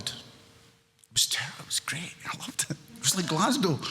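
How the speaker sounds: a soft conspiratorial whisper; hushed, confiding whisper; whispered aside. Real, not acted. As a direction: let them in on a secret. Confiding aside about the bar.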